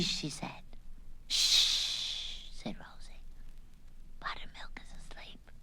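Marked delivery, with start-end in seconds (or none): 1.3-2.5 long, breathy shush. Acted. Rosie hushes for sleeping cat. 4.2-5.5 very soft, breathy hush; whisper. Acted. Rosie hushes for sleeping cat.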